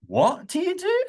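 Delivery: shocked what do you do; surprised. Shown as a demonstration. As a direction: react to strange news. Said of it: "After someone has told us something strange".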